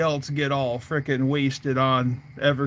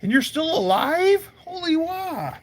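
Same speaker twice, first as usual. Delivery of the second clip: much higher, stunned disbelief; astonished; 12 semitones higher than usual. Retold or imitated. Teller amazed his buddy survived, very high pitched. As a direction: gasp it out, way up high. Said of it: "I'm like".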